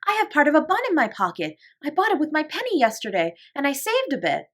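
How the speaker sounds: put-on high, eager little girl; Lottie's voice. A narrator's reading. Lottie tells Sarah she likes the attic, high and eager. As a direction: bounce it like a delighted small child.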